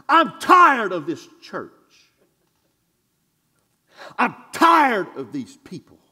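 shouting out weariness from the pulpit; preached shout. Acted. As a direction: belt out that you are fed up. Preacher venting to the church.

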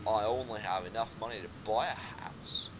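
low, hesitant try at Australian; half-hearted Australian accent; attempted Australian accent. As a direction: have a go at Australian. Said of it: "I'll go Australian".

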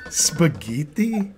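slow drawn out Spaghetti with Italian vowels; mock Italian spaghetti. Mocking a TV chef's Italian accent, slow exaggerated. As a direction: Spaghetti stretched, spa-GEH-tee, Italian style. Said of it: "Italians don't say".

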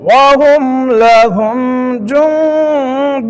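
slow drawn-out chant, words held long; tilawat recitation. Shown as a demonstration. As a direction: intone the verse slowly. Quran recitation. (ধীর সুরে তিলাওয়াত)

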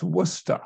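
Worcester said as "WUSS-tuh", falling; local pronunciation. Shown as a demonstration. Speaker teaching newcomers the city's name, local pronunciation. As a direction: say Worcester in two syllables, short vowel, dropped r. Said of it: "how to pronounce the name".

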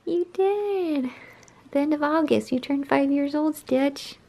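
pet talk. Real, not acted. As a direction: coo tenderly at a sleepy kitty. Telling cat his birthday.

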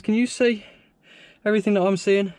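ordinary delivery

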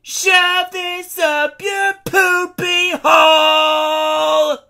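very high, slow screaming yell; shout. Acted. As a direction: scream it out at the top of your lungs.

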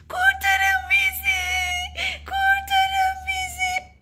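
high, slow, sobbing scream for rescue; screaming for help. Acted. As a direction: scream it like a trapped toy in panic. Trapped toys scream for help.

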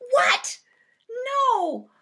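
high, loud outraged what, no; indignant high outburst; shocked protest. Acted. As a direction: object in sudden disbelief. Outraged at the song's lyrics.

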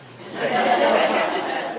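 a short breathy laugh at the memory; light laugh. Real, not acted. Eulogist laughing at his own line about Uncle Bob, short laugh.

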